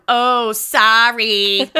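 slow, drawn-out mock English "Oh, sorry."; a light parody of an English accent, stretched slowly. Mocking how the movie cops mock Englishmen, slow mock English accent. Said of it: "making fun of their English accents".

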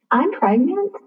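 high, rising, unsure "I'm pregnant"; hesitant, questioning upward lilt; tentative confession. Retold or imitated. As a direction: break the news timidly, as if asking. Hesitantly breaking news to grieving mother-in-law.

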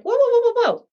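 shrill-free high stopping call; high alarmed whoa. Retold or imitated. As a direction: stop them short, startled. Coach stopping client.

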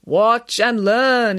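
very slow, loud ghostly instruction; mysterious. A narrator's reading. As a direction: draw each word out eerily. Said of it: "instructed Michael mysteriously".

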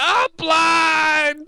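high, loud pained shout. Pretend. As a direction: yell out in comic panic. Imitating a victim hit by milk.

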